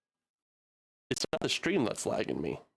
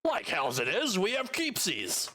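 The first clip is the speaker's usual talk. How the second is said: indignant goblin; 8 semitones higher, slower than usual. Acted. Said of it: "Skirk runs over and he goes".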